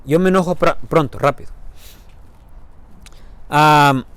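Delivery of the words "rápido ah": ordinary delivery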